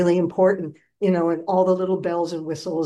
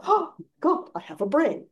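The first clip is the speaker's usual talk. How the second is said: amazed scarecrow; 8 semitones higher than usual. Retold or imitated. Scarecrow discovers his brain.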